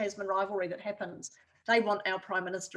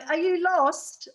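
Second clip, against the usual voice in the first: put-on slow, loud, higher New Zealand accent calling out; a linesman's easy New Zealand accent, drawn out and called up high; 9 semitones higher, 7 dB louder, much slower than usual. Linesman on a telephone pole calls down to a lost driver, slow and loud in a New Zealand accent. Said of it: "I heard this New Zealand accent".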